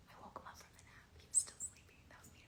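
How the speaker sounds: hushed whisper to the camera. Real, not acted. Telling fans about waking the dog, whispered. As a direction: whisper so the sleepy dog isn't bothered.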